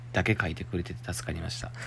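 whispered aside of relief. Real, not acted. Host whispering thanks to the listener. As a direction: breathe it like a secret.

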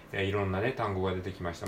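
ordinary talk, nothing marked

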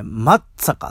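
松坂 said as "Mattsaka", with a tight doubled ts; local pronunciation. Shown as a demonstration. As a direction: squeeze 松坂 into a punched "Mattsaka". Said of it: "僕らの地元ではね".

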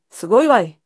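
normal talking voice

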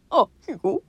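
lightly voiced Goofy; hint of Goofy's lazy ease; Goofy's mannerism. A light Goofy quip showing just his speech habit. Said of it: "喋り方の癖だけ".

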